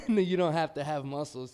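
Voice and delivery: loud laughing. Real, not acted. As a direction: laugh it out at yourself. Laughs at own lack of muscles.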